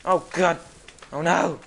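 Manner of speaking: loud cry of dread; sudden panicked exclamations; alarmed shout. Real, not acted. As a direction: yell as danger appears. Boss appears.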